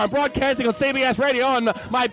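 irritated broadcaster, rapid patter; indignant radio host; old-time radio announcer. Acted. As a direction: complain on air like a vintage radio man. Stays in radio announcer character.

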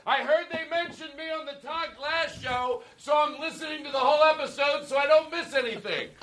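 hammed-up, unashamed holler; confident proclamation. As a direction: declare it proudly for all to hear. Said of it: "That's what a confident person does".

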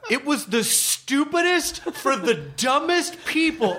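high, slow, emphatic mock disdain; imitated scornful rant. Comic retelling Bob Odenkirk trashing vaudeville, mock scornful rant. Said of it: "he was the first one ever who was like".

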